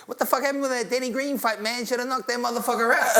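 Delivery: high-pitched ranting champ; squeaky, worked-up boxer impression; Mike Tyson. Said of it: "It's Mike Tyson. He goes".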